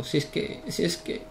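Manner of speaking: whispered under his breath. Real, not acted. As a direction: breathe it out, voiceless. Muttering to himself.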